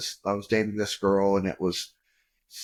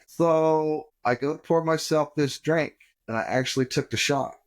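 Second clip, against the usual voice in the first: normal talking voice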